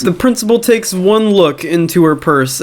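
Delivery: ordinary delivery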